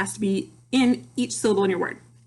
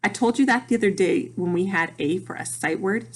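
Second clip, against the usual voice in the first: ordinary talk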